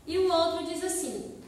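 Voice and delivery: ordinary delivery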